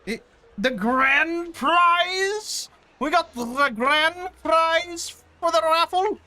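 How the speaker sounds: high, slow booming announcer call; booming prize announcer. Acted. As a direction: boom it out like a game-show host. Raffle grand prize announcement.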